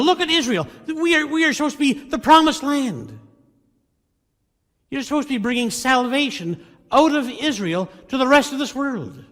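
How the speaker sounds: high, loud anguished outcry; crying out in anguish. Retold or imitated. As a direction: hurl the questions at God in despair. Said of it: "he's crying out to God".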